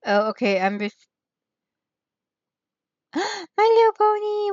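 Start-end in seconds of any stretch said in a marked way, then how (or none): 3.1-4.5 excited gasp into a high-pitched cry; real delight. Real, not acted. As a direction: squeal the name like a thrilled fan. Spots My Little Pony with delight.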